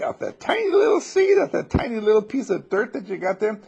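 very high, loud astonished cry; amazed. Pretend. People amazed at pumpkins from a tiny seed, high and loud. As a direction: gasp in wonder at the pumpkins.

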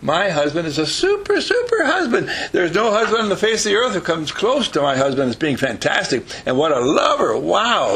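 high-pitched, hammed-up bragging; delighted, over-the-top admiration; gushing praise. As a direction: rave about your wonderful husband. Models gushing praise of husband.

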